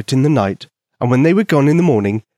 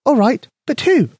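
high, tiny mouse voice; squeaky mouse voice; 12 semitones higher than usual. A narrator's reading. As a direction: voice a small eager mouse. Mouse Penny speaks.

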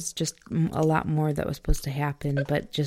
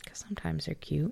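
whisper; 9 dB quieter than usual. Real, not acted. Joking aside to partner.

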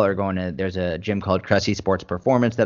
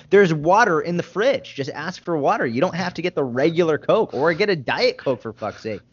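higher-pitched, exasperated pleading; exasperated scolding; 6 semitones higher than usual. Retold or imitated. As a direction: plead with them in exasperation, dropping off at the end. Acted exasperated scolding over soda.

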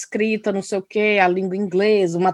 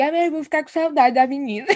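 higher, whiny husband imitation; husband imitated, pitched up and sulky; mimicking husband; 6 semitones higher than usual. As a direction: play the sulky dad missing his girl.

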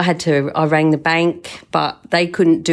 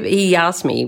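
ordinary talk, nothing marked

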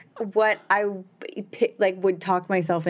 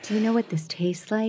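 seductive voice; 4 semitones higher, 3 dB quieter than usual. Retold or imitated. Voicing the tempting addiction.

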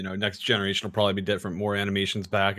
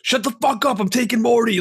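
high, loud abusive yell; yelling Rick impression; 12 semitones higher, 4 dB louder than usual. Rick yelling abuse at Morty, angry high-pitched shout. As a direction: yell it at Morty like a furious bully. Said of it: "he's always beating and yelling at Morty".

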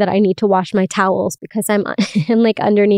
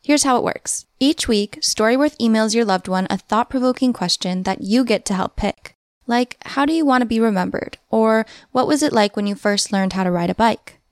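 ad read; 3 dB quieter than usual. Acted. Host reads a sponsor ad.

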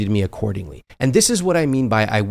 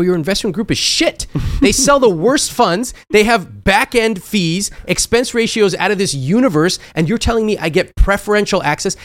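scornful retort; 8 semitones higher than usual. Retold or imitated. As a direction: lay into the bankers' pitch. Scornful retort to bank pitch.

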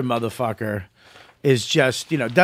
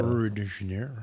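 mock announcer; 5 semitones lower, 10 dB quieter than usual. Mocking the phone hold voice.

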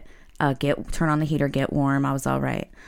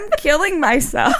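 laughing; 12 semitones higher, 7 dB louder than usual. Real, not acted. Laughing at the joke.

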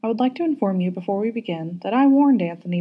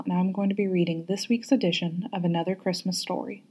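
ordinary delivery, nothing marked

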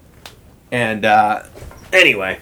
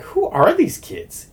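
amazed; 10 semitones higher, slower than usual. Retold or imitated. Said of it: "And I was like".

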